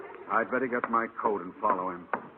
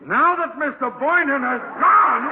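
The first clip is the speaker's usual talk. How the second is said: sudden outburst; 14 semitones higher, 7 dB louder than usual. Acted. Principal yells at the closet, suddenly suspicious. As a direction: blow up as the penny drops. Conklin realizes, explodes.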